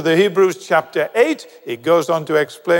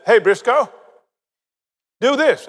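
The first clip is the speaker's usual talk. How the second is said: brisk, raised-pitch order; blunt command; 6 semitones higher than usual. Acted. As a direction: give a short, no-coaxing instruction. Acting out God's blunt command.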